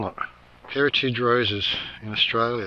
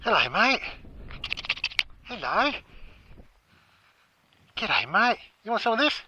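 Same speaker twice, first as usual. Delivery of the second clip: high cooing voice, softer, for the animals; talking to animals; 8 semitones higher, 4 dB quieter than usual. Acted. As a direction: coo at a sweet creature like a pet. Coaxing animals to eat.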